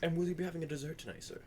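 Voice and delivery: waiter's put-on French accent, quick. Waiter serving a guest dessert, in a French accent. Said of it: "I noticeably drop my French accent".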